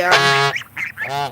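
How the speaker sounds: ordinary talk, nothing marked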